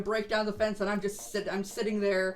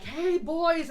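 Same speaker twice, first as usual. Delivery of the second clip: calling out; 8 semitones higher, 4 dB louder than usual. Retold or imitated. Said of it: "I'm like".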